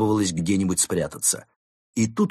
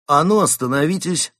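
loud, raised voice of authority; commanding; 6 semitones higher, 6 dB louder than usual. A narrator's reading. Police moose calling from behind, commanding. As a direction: order them to halt. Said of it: "раздался сзади повелительный голос".

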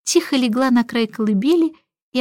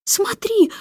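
put-on higher, thrilled girl's voice; delighted cry; 6 semitones higher, much slower than usual. A narrator's reading. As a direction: call out in delight. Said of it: "восторженно закричала Джейн".

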